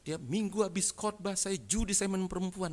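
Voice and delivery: high, drawn-out proud confession; mock boastful testimony. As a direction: imitate someone showing off past sins. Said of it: "dengan bangganya".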